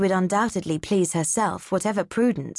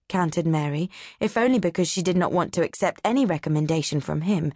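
usual speaking voice

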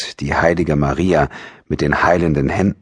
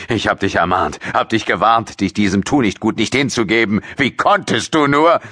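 father's reproach rising higher and higher; angry scolding; 6 semitones higher than usual. A narrator's reading. Father rebuking his pregnant daughter, building angry scolding. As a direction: scold her, building to a high outburst. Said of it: "fuhr er sie an".

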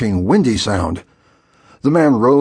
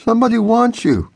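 put-on groggy boy; 12 semitones higher, faster than usual. A narrator's reading.

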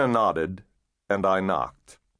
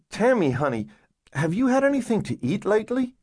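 put-on higher-pitched woman coaxing a child; coaxing caseworker; 12 semitones higher than usual. Caseworker coaxing a girl to open up, reassuring voice.

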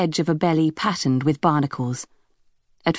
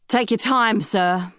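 mocking shout; 5 semitones higher, slower than usual. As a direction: shout it across the deck with a sneer. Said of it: "the captain shouted".

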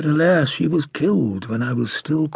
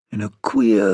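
ordinary delivery

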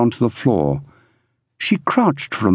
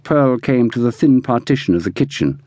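ordinary delivery, nothing marked

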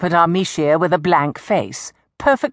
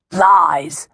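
put-on old woman's accusing cry, lightly roared; mild roar; 3 dB louder than usual. A narrator's reading. Old woman accuses the bound witch, restrained roar.

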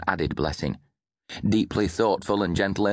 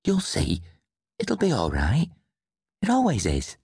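put-on high, hushed-style child's voice, not a true whisper; whispering boy; 8 semitones higher than usual. A narrator's reading. Boy comforting his upset grandfather, high whispering-boy voice. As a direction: soothe him in a small boy's voice. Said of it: "Davy whispered".

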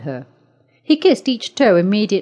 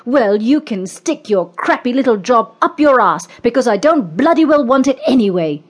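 angry; 5 semitones higher, 3 dB louder than usual. A narrator's reading. Quitting woman rages at boss.

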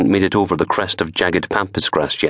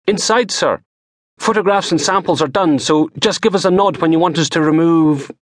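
technician voice; 11 semitones higher than usual. Acted. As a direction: voice the tech up high. Technician voiced, high throughout.

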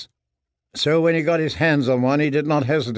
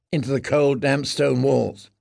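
ordinary delivery

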